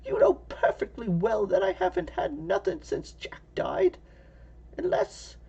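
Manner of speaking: put-on high hushed old woman whisper; whispering old lady. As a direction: whisper it like a fearful granny.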